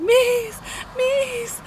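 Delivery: high re-enacted pet call; calling the cat. Retells calling for lost cat.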